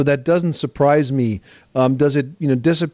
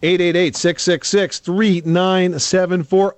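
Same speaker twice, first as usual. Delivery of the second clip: slow, emphatic number read; phone number read; 4 semitones higher, slower than usual. Acted. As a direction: spell the number out for listeners.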